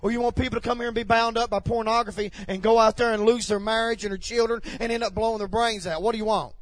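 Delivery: loud, challenging sermon voice; fiery preacher demanding an answer; impassioned preaching. Real, not acted. Challenges the congregation forcefully.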